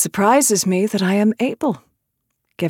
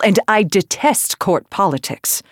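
ordinary delivery, nothing marked

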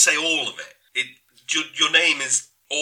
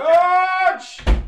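loud, high shout after him; calling out; 8 dB louder than usual. Acted. Calling after George as he leaves, loud. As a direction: yell his name at the door.

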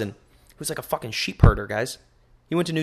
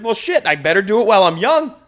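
put-on high and giggling, louder, old man's line; laughing through the quote; 7 semitones higher, 7 dB louder than usual. Mock old man deciding on a sabbatical, laughing. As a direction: crack up while quoting him. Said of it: "He's like".

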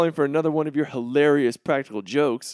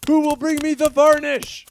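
much higher, louder desperate cry; panicked plea; 10 semitones higher, 5 dB louder than usual. Acted. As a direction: plead in full panic. Friend hanging off building.

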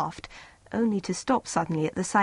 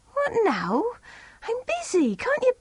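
higher, impatient wife; wife brushes off husband, higher and impatient; irritated wife voice; 14 semitones higher than usual. A narrator's reading.

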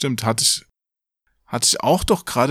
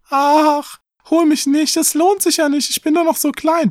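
very high falsetto plea; squeaky, loud little begging voice; pleading little goat; 4 dB louder than usual. A narrator's reading. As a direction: beg in a tiny kid-goat voice. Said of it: "Der Jüngste aber bat".

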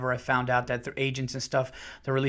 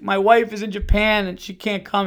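mock tearful plea, higher and louder; retold crying; 6 semitones higher, 6 dB louder than usual. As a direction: imitate a man breaking down as he explains. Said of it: "and he starts crying".

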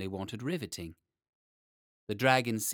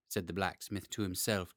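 usual speaking voice

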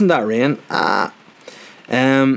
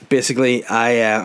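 usual speaking voice

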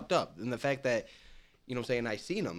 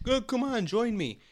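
pushy party coaxing; 11 semitones higher, 4 dB louder than usual. Retold or imitated. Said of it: "trying to get other people".

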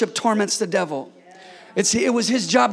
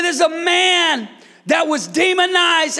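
ordinary talk, nothing marked

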